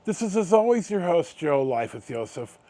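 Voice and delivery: ordinary talk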